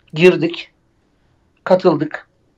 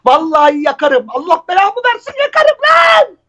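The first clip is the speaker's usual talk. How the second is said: angry tough guy; 3 semitones higher than usual. Acted. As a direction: threaten like a street thug, rising to a yell. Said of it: "beş liralık mazotla yakarım desene".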